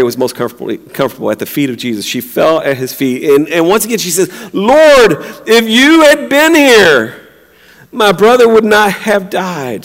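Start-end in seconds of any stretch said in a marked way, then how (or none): 4.5-9.8 raised, drawn-out plea to the Lord; hopeless lament. Retold or imitated. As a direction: mildly voice Mary's grieving reproach. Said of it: "she's hopeless".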